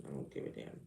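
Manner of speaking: breathy dismissive mutter under the breath; whispered aside. Real, not acted. Muttering to viewers who'd censor her. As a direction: toss it off in a whisper.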